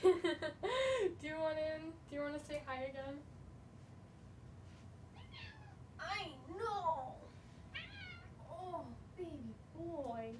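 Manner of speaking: hushed cooing to a pet. Real, not acted. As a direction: coo gently, almost under breath.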